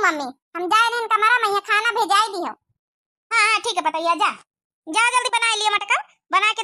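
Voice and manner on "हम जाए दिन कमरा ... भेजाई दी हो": ordinary talk, nothing marked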